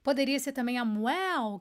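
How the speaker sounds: ordinary talk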